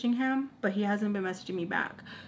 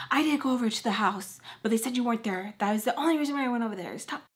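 higher, rushed imitation of mom; exasperated; 3 semitones higher, faster than usual. Mom finally relenting to crying daughter, exasperated. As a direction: rattle it out impatiently, ending high.

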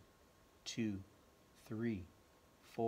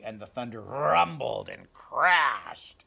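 rumbling thunder; 10 dB louder, slower than usual. A narrator's reading. Thunder described loudly.

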